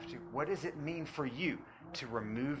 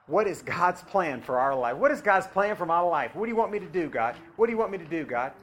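pleading believer; 5 semitones higher, 13 dB louder than usual. Acted.

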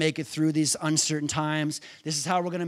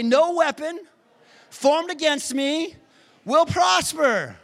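much higher, louder, slow shouted declaration; call to repeat; 11 semitones higher, 6 dB louder than usual. Shown as a demonstration. Preacher leading the congregation in a shouted declaration. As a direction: shout each phrase for them to echo.

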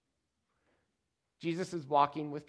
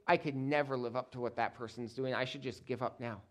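lower, softer voice of giving up; mildly defeated; 5 semitones lower, 6 dB quieter than usual. Acted. Preacher voices a believer who cannot measure up, mildly defeated. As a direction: sink down in mild discouragement.

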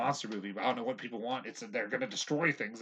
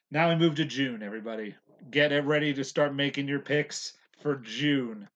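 hyped radio host, building louder; announcer voice; 3 semitones higher, 8 dB louder than usual. Acted. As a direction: pump up the listeners for June. Announcer style movie picks.

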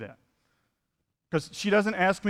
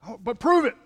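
mocking skeptic; 7 semitones higher, 5 dB louder than usual. Preacher voicing skeptics demanding proof.

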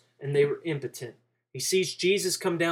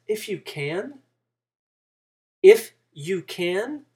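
challenging; 4 semitones higher, 4 dB louder, slower than usual. Acted. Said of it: "He's challenging the father's words".

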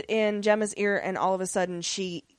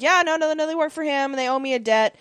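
higher-pitched, glib cover story; mock-earnest fib, pitched up; glib lying; 7 semitones higher, 4 dB louder, faster than usual. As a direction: rattle off a smooth lie, imitating a fibber. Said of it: "gives him a big old fat lie".

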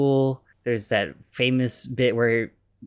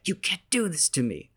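higher, put-on voice of indignant outrage; outraged villain; 7 semitones higher than usual. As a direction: protest like a wounded tycoon. Norman ousted from board.

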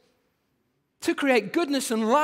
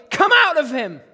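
big, loud, higher command for the camera; showy shout; 4 semitones higher, 10 dB louder than usual. Acted. Mock showman performing once the camera is rolling, showy shout. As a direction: bellow it as a hammed-up performance.

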